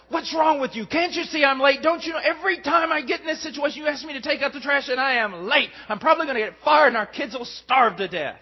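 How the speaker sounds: loud, fast, high-pitched rant at his wife; angry outburst. Retold or imitated. Husband yelling at his wife over the trash, angry shout. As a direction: blow up at her, late and fed up.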